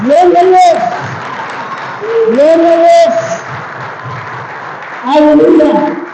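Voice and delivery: high-pitched calls of praise; praise cry. Real, not acted. Preacher to applauding congregation, crying out praise. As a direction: call it out high over the applause.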